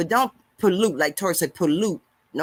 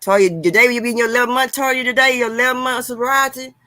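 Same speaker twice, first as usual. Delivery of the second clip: loud, fast, high cheer for her friend; excited cheer; 6 semitones higher, 6 dB louder than usual. Real, not acted. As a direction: hype her up loudly, fast. Cheering a viewer's sobriety milestone.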